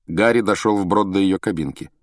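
ordinary delivery, nothing marked

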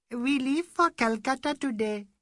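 a bright, calm high announcement; high Indian lady. A narrator's reading. Mrs Bhattacharya telling the ladies, high and light.

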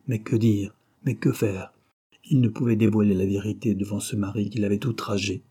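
plain reading voice

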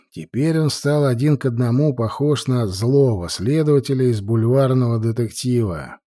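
ordinary delivery, nothing marked

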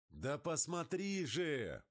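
high, long-stretched insistent yell; insistent drawn-out shout; urgent shouting. A narrator's reading. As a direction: urge them to look, shouting high and slow. Pilot urges passenger to look.

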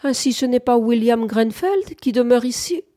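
astonished, lifted voice; surprised young soldier. A narrator's reading. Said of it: "la voix surprise du jeune soldat".

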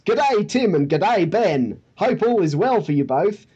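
hammed-up Australian G'day, Tim; Australian accent. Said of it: "therefore you get the accent".

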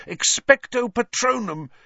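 high, urgent incantation; high-pitched, forceful spell-casting; desperate cry. A narrator's reading. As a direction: cry the spell out against the fog. Fighting on as screaming grows.

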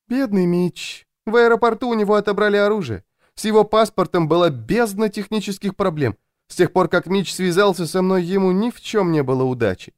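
high, plaintive woman's voice; pitying Louisa. A narrator's reading. Louisa laments her unlucky bodyguard, pitying.

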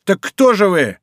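high, alarmed question; восклицание. A narrator's reading. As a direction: cry out the question in dread. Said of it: "воскликнул палач". (испуганно восклицая)

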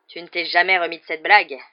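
loud, grumbling retort; growled. A narrator's reading. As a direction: growl it at an old enemy. (grognement hostile)

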